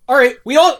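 ordinary delivery

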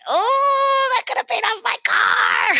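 very high, wailing regret; squealed comic anguish; mock lament. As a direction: wail it high like a hammed-up sob. Mock lament over lost money.

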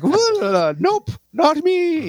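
very high mock squeal; squeaky Gracie voice. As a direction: squeal it like a cartoon girl. Voicing Gracie's escape.

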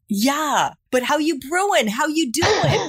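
high-pitched, hammed-up New York accent. Host prods guest who missed the pun, playful New York accent. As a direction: push the mock New York accent to sell the joke. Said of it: "my New York accent finely tuned".

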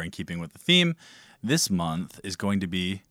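ordinary talk, nothing marked

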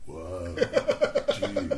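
stretched-out, amused surfer-style "Whoa, dude"; Keanu Reeves. Retold or imitated. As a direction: play a dazed, awed stoner. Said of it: "Keanu Reeves shot".

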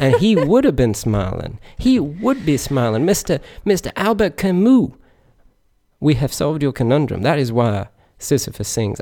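rising impassioned oratory; James Joyce preacher voice. Pretend. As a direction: preach it like a fiery sermon. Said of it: "my James Joyce preacher voice".

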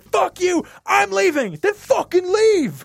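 high mock shouting match; imitating shouting neighbors. Retelling the neighbors upstairs arguing, mock shouting. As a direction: yell it like the neighbors upstairs fighting. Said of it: "shouting at each other".

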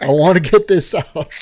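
loud laughing interruption. Real, not acted. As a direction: push the words out through a laugh. Cutting in, laughing.

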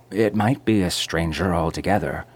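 ordinary delivery

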